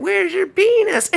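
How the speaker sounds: shrill high jeering; mocking women. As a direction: taunt like giggling women.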